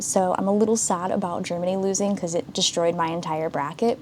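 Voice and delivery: normal talking voice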